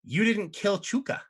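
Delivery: high, sneering little imp. Acted. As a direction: needle them like a petty imp. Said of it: "and they say".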